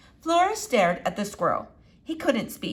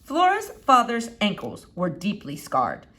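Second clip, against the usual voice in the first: usual speaking voice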